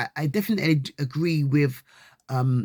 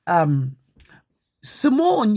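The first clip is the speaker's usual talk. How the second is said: ordinary delivery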